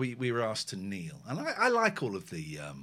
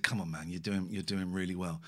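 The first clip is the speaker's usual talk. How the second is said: soft, low retold reassurance; acting out gentle encouragement; comforting; 6 semitones lower, 5 dB quieter than usual. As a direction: console a friend quietly. Retells comforting a crying friend.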